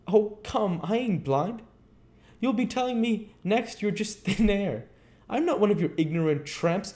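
high, loud, incredulous tramp voice; scoffing tramp. Acted. Tramp refuses to believe in thin air, scoffing.

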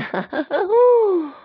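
high squeal of laughter. Real, not acted. Reader amused by the girls, high laugh. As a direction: laugh brightly.

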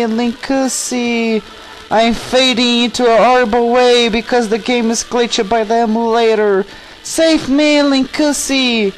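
high, drawn-out hammed-up plea; wailing damsel in distress. Tetra begging Link to save her, high mock wailing. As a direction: wail slowly and high for rescue. Tetra cries for help, fading away.

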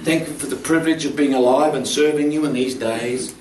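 low, measured praying voice with pauses; earnest prayer. Real, not acted. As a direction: lead the congregation in prayer, softening into hushed worship. Leads a closing prayer.

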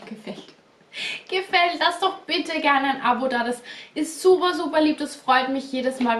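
ordinary delivery

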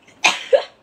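sharp loud cough burst; sudden cough. Real, not acted. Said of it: "I have to sneeze again".

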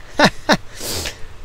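sheepish little laugh, caught cheating. Real, not acted.

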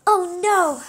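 loud high alarmed oh no; alarmed cry. Acted. Arietti spotting the giant, loud alarmed cry. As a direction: cry out in a panic.

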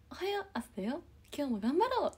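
very high sing-song mascot wake-up call; Korasho alarm voice. Retold or imitated. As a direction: chirp it like a kids' alarm clock character. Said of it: "そんな感じのイントネーションのやつ".

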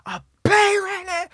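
high, loud, drawn-out angry outburst; snapping. Retold or imitated. Son snapping at the visitor over his room, loud high mock outburst. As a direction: imitate someone losing it, voice shooting right up. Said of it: "My man snapped".

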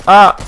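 sharp startled shout; startled yell. Real, not acted. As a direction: yelp as if suddenly shot. Sudden shot from window.

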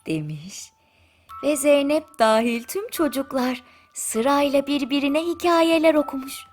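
ordinary delivery, nothing marked

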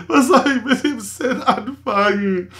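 hammed-up sob in the voice; mock despair, half crying; mock whimper. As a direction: fake tearful whine over a toy set. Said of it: "ironische, sarkastische Nummer".

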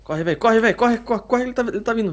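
high, urgent run-for-it calls; being chased. Real, not acted. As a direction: scramble away in a panic. Fleeing, urging partner to run.

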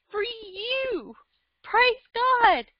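sudden high burst of "Praise God"; fervent cry of praise; rising cry of praise. Real, not acted. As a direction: let the praise break out up high. Preaching rises to a high cry.

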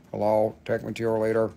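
low, odd alien greeting. Acted. Seller voicing the rock's alien face for viewers, low alien voice. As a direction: play the rock as a little alien. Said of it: "it's like an alien".

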